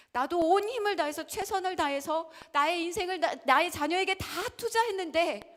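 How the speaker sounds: loud, high, pained outcry; anguished parent cry. Acted. Preacher voicing a hurt parent, crying out. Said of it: "preacher voicing parent's anguish".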